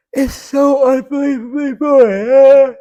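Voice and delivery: yawned out, high and slow; yawning. Real, not acted. Bored reviewer yawning through the verdict on the plot. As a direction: stretch it through a real yawn. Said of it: "I'm yawning".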